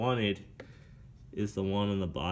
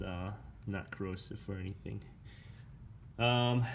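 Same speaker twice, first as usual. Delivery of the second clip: ordinary talk, nothing marked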